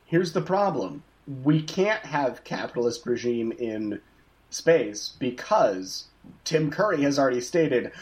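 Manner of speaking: ordinary talk, nothing marked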